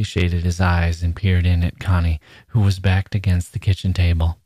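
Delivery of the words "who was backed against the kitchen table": ordinary delivery, nothing marked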